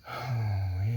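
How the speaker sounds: long audible sigh; weary sigh. Acted. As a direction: let out a drawn-out sigh. Acted monologue, lamenting writer's life.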